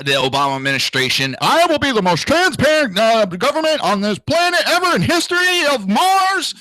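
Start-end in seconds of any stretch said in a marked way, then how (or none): 1.4-6.6 hammed-up politician's grand claim; mock pompous pledge. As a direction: parrot the promise in a high, mocking voice. Mocking Obama administration pledge.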